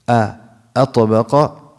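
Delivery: "atbaqa" slowly, with a clear opening "a"; pronounced initial hamza. Shown as a demonstration. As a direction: sound the first "a" of "atbaqa" distinctly. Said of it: "it's atbaqa".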